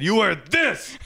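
high, strained comic command; over-the-top parental command; mock scream. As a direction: order it in a put-on high yell. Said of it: "I screamed".

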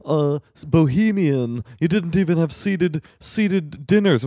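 high, slow, snooty mock aunt; imitating a judgmental aunt at the wedding. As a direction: drawl it like a sniffy relative. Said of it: "everybody was like".

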